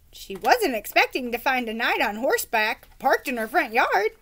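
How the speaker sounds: ordinary delivery, nothing marked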